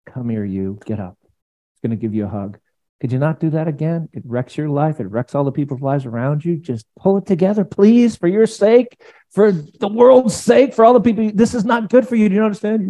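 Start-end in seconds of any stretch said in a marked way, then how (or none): 0.1-2.6 tender Jesus. Acted. Preacher voices Jesus tenderly. 6.6-12.9 scolding false Jesus. Acted. Preacher mocking a scolding Jesus, high exasperated nagging.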